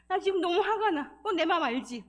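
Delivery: loud, high burst of frustration; venting anger. Shown as a demonstration. Counselor venting to the self in the mirror, loud and angry. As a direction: let it out forcefully to your own reflection. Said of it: "거울에 있는 나를 보고".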